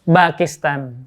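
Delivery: "Pakistan" with a voiced b, as "Bakistan". Shown as a demonstration. Teacher showing how Arabs say the country name with b.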